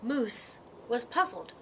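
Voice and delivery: plain reading voice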